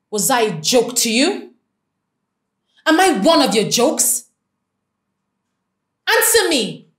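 loud, rising angry accusation. Acted. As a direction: demand an answer, furious. Furious about his engagement.

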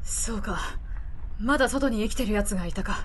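put-on gruff weary older man, low. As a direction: speak low like a tired drifter. Voices the middle-aged reporter.

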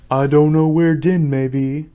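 slow, drawn-out turtle voice; Mock Turtle figure. A narrator's reading. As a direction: draw the words out as a slow creature. Mock Turtle answers in story.

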